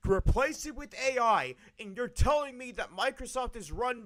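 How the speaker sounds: furious streamer rant about layoffs; angry rant. Real, not acted. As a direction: vent in real outrage at the waste. Heated rant about Microsoft spending.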